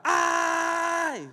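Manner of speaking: high sudden yelp of pain; son's cry of pain. Retold or imitated. Father acts out his son's pained yelp. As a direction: yelp as if the thigh was just touched. Son screams when thigh touched.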